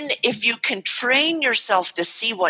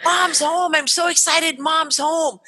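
put-on excited kid; 8 semitones higher than usual. Kids excited mom's home.

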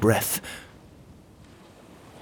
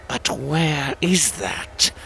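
toad lord; 5 semitones higher, much slower than usual. Acted. Toad asks the Queen.

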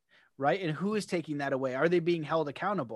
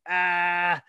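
imitating a guy bragging to a cop, louder; boastful loud brag; 8 dB louder, slower than usual. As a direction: crow it over the cop like a punchline. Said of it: "Then he goes".

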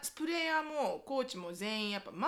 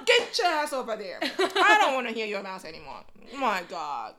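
slow, much louder scolding; angry mom; 9 dB louder, much slower than usual. Retold or imitated. Mother scolding her son, slow loud furious scold. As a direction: tear into him slowly. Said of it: "超キレてんの".

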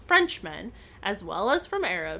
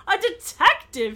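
higher, louder startled cry; exclaiming; 9 semitones higher, 5 dB louder than usual. Acted. Frenchman startled to learn Nancy is a detective, exclaiming. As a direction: catch the word in shock. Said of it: "he exclaimed".

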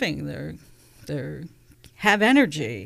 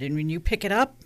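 ordinary delivery

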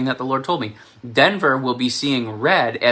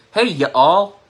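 higher, louder mock Southern "Hey, y'all"; Southern accent; 6 semitones higher, 6 dB louder than usual. Shown as a demonstration. Mocking how they talk down south, hammed-up Southern. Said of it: "saying the word y'all is y'all".

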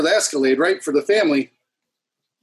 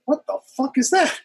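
high-pitched, baffled reaction; startled disbelief; 11 semitones higher than usual. Retold or imitated. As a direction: blurt it out, baffled and higher. Acted reaction to lights behind him.

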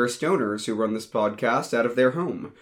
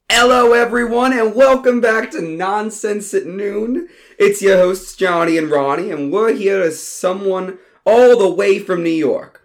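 higher, louder, upbeat host voice; podcast host intro; 7 semitones higher, 6 dB louder than usual. Acted. Johnny opening his show, a loud upbeat host intro. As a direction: welcome the listeners big.

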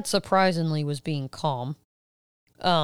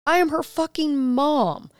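high, slow, emphatic retold yell; indignant outburst; 9 semitones higher, 3 dB louder, slower than usual. Mother retelling her outburst to Mimi, slow and emphatic. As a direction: insist she is the mother.

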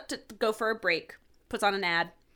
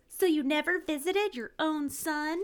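put-on higher, slow boy's voice; eerie boy caller; 7 semitones higher, slower than usual. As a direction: ask it like a ghostly child. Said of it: "the caller goes".

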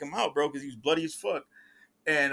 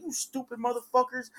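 snapping; 8 semitones higher, 3 dB quieter than usual. Retold or imitated. Little brother turning on his guests, retold snapping. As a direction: imitate an exasperated telling-off. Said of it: "he's snapping on him".

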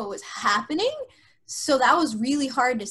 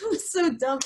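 ordinary talk, nothing marked

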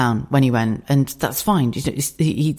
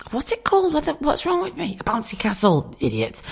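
frustrated; 11 semitones higher, 4 dB quieter than usual. Real, not acted. Forgetting the word bouncy castle.

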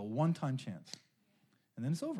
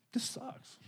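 breathy grumbled whisper, pitched up; bummed whisper; 7 semitones higher, 8 dB quieter than usual. Retold or imitated. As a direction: sigh it out under your breath like a sulking kid. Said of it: "I was really bummed about it".

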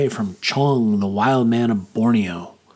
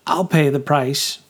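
trying to sound serious; 3 semitones higher than usual. A narrator's reading. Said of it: "in what I hoped was a thoughtful and serious voice".

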